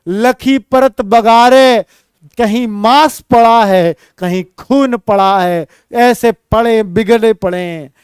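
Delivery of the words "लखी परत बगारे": slow, drawn-out chanting of the verse; poem recital. Shown as a demonstration. Teacher slowly chants a verse line to students.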